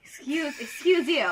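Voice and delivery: mock offended. Teased by a friend, firing back in mock offense. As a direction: act playfully insulted at the jab.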